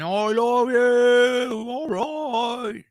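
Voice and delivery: high, very slow slurred British drawl; slurry British accent. Retold or imitated. Aging rocker telling the crowd he loves them, slurry British accent. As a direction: stretch it out in a lazy British slur. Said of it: "this weird voice, slurry sounding British accent".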